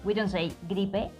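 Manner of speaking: ordinary talk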